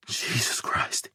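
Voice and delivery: whispered slow, under the breath; relieved father breathing out a whisper. A narrator's reading. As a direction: let it out on a shaky whisper. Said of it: "he breathes".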